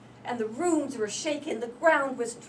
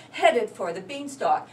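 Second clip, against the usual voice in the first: ordinary delivery, nothing marked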